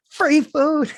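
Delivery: high, loud yell of "Free food"; a sudden, silly shout of "Free food" to startle strangers; mock yell. Shown as a demonstration. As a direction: call out "Free food" like a street crier. Demo random yelling.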